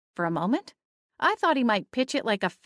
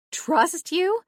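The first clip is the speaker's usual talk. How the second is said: sharp high disbelieving question; high mocking echo of his own words; scornful disbelief; 11 semitones higher than usual. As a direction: fling his words back at him in scorn.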